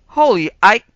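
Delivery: loud, high shocked shout; startled bark. A narrator's reading. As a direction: bark it out in alarm. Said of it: "barked the officer".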